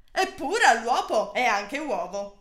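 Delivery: usual speaking voice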